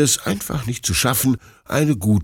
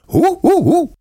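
high, loud little barks; excited puppy yaps; 6 dB louder than usual. Acted. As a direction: yap three times like an eager pup.

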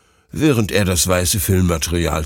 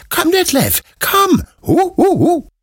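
high, eager little puppy voice, yipping; excited puppy Bruno; 5 dB louder than usual. A narrator's reading. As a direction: bounce up and down like an overjoyed puppy. (aufgeregter kleiner Welpe, hohe Stimme)

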